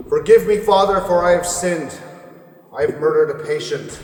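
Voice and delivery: loud, high call into the empty asylum; high, loud and pleading; loud confession. Acted. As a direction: call the confession out to the dead priest. Calling out a confession to spirit.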